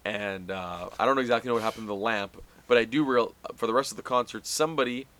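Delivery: ordinary talk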